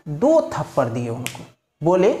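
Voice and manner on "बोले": ordinary talk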